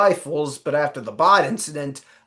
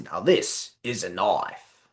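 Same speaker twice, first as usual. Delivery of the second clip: low, slow Australian drawl; Australian accent; 7 semitones lower, 4 dB quieter than usual. Retold or imitated. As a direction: Crocodile Dundee style Aussie line. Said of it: "my Australian accent is not as, uh, good as you think".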